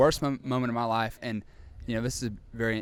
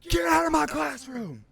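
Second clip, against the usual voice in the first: much higher and louder scream of "Get out!"; teacher screaming; 5 dB louder, much slower than usual. Retold or imitated. Strict teacher screaming at the class, mock furious yell. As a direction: bellow like a furious teacher throwing kids out. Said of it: "she screams at everybody".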